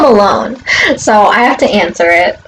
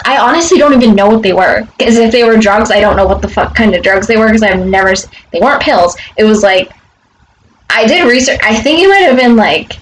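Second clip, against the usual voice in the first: ordinary talk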